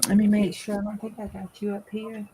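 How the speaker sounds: face turned from the mic; muffled, like through a wall. Real, not acted. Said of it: "Now you sound muffled".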